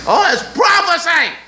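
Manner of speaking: high mocking shout; hammed-up, high-pitched proclaiming; mock exclamation. Mocking others' excited claim.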